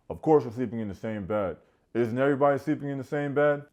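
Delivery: loud, rising imitation of exasperation; hammed-up loud defensiveness; mock indignant outburst. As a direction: protest it as blindingly obvious, loudly. Acts JR's indignant answer.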